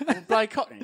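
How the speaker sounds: joking Cockney reveal; mock Cockney accent. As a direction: lightly ham up Cockney. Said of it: "putting on a nice British accent".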